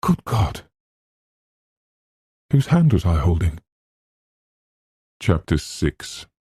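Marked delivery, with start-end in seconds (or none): 0.0-3.6 horrified. Acted. Eleanor realizes horror.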